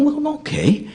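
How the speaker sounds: high, hesitant mock reluctance; reluctant agreement. A tourist grudgingly agreeing to hear the monk's story, reluctant.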